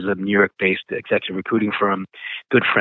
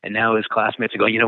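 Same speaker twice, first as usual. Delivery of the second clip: normal talking voice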